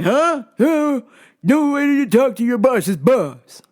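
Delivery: high, slow offended huff; indignant customer voice. Retold or imitated. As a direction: play the offended big shot.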